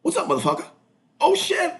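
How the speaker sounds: cocky challenge breaking into high alarm; hammed-up confrontation with a squeaky recoil; imitating friend rushing back. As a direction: act out her storming in, then startled. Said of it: "her rushing back going".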